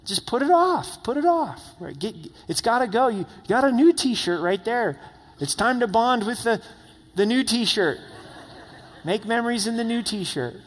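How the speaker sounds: high-pitched voice playing his wife, insisting; the wife telling him it's time to let the old shirt go; wife's voice. Wife voiced urging to discard shirt.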